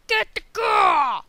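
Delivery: high, drawn-out shout; imitated viewer yell. Viewer urging the player, imitated loud high yell. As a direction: yell an impatient mock order. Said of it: "I know you're like".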